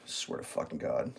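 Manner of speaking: low unvoiced exasperated whisper; real whispered swear; whispered curse. Real, not acted. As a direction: mutter it in disbelief. Exasperated aside.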